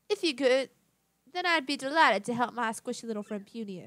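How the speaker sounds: lightly voiced grand lady, fussy; vain genteel madam. Madam refusing to go out without her necklace, fussy genteel voice. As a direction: make a dainty fuss, with a touch of grandeur.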